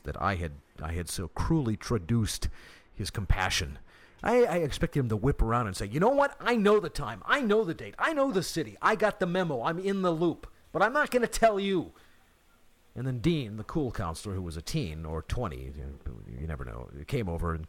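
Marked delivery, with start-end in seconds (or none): 5.9-11.9 smug. Pretend. Imagined pastor's smug retort.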